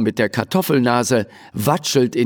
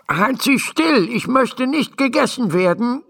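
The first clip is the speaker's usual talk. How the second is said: much higher, worried tiny doctor; fussy little doctor; 13 semitones higher than usual. A narrator's reading. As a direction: fret from inside the mouth. Doctor inside horse's mouth. (ängstlicher kleiner Doktor, viel höher)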